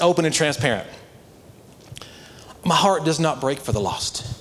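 ordinary talk, nothing marked

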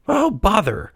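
high, frustrated puppet voice; high-pitched petulant grumble; huffing. A narrator's reading. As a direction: huff in childish annoyance. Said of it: "huffed Pinocchio".

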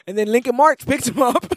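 normal talking voice

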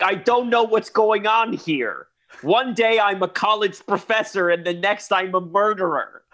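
Malkovich attempt. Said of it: "I was doing it thinking".

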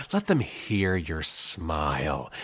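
low, slow coaching line; lightly acted voice director giving a note; director coaching narrator. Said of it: "they told the narrator".

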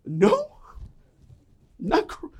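very high cracked protest; dad caught crying, squeaky denial; flustered denial. Retold or imitated. Dad denying tears.

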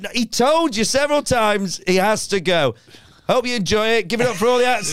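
high stage sign-off voice; projected compere announcement. Retold or imitated. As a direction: shut down the encore calls, projecting.

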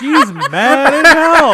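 loud laughing shout. Real, not acted. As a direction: howl it through laughter.

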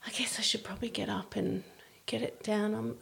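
high, breathy near-whisper of a wife dropping hints; coy hinting wife. Retold or imitated. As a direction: sigh out the hint so he offers to do it. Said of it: "these women would go".

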